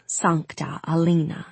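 plain reading voice

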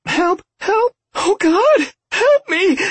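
high, panicked screaming; screaming for help. A narrator's reading. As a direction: shriek it in terror.

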